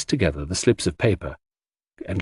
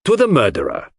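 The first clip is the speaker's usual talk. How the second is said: with a flourish; 9 semitones higher, 4 dB louder than usual. Acted. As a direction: announce the culprit like a showman. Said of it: "said Poirot with a flourish".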